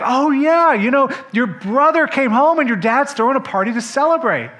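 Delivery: high, loud and breezy news; cheerful servant. Acted. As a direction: blurt out the happy news.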